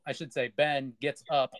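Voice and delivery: ordinary talk, nothing marked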